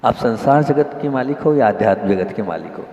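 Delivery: ordinary talk, nothing marked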